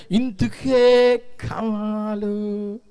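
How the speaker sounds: stretched-out whiny plea, very slow; hammed-up crying prayer, mocking a churchgoer; mock wailing prayer. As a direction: drag every syllable like a self-pitying beggar. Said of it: "ఏం ఏడుపు అది?".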